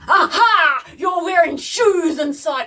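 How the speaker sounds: high, loud mock gotcha; accusing aha. Dad catching daughter in shoes, mock accusing shout. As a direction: imitate a dad triumphantly catching someone out. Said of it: "he looked at me and he's like".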